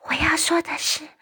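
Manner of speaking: barely voiced whisper. Sophie keeps whispering to the giant. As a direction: keep it to a breath. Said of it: "哦，好多了啦".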